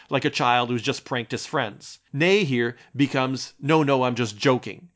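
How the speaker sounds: normal talking voice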